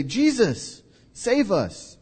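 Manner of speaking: high pleading cry for help; pleading call. Acted. Disciples calling to Jesus from the boat, high pleading cry. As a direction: call out begging to be saved.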